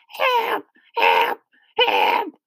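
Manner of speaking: tiny, high squeaked cries; mock cries of a stuck spider; spider grandmother calling for help. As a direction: call out in short, pitiful high squeaks. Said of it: "we could hear her calling to us".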